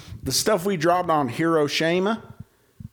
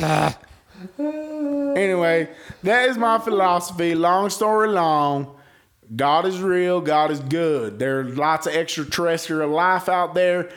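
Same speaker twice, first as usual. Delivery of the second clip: ordinary talk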